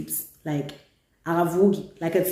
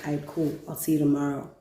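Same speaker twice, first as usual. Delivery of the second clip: quiet, flat-voiced brush-off; softer, icy and unimpressed; a hint of a quietly seething girlfriend; cold curt reply; 4 semitones lower, 8 dB quieter than usual. Coldly ending call with cheating boyfriend.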